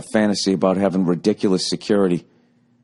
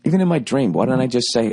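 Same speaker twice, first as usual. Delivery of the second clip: ordinary talk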